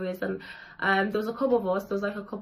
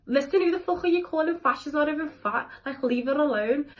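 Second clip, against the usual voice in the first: friend defending her; 6 semitones higher than usual. Retold or imitated. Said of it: "My day one was like".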